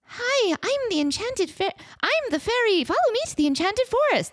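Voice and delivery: put-on high little girl fairy. Shown as a demonstration. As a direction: pitch right up like a small girl. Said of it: "like a little girl voice".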